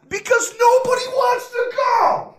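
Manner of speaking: high, loud exasperated yell; exasperated shout. Real, not acted. Exasperated rant about a pointless contest.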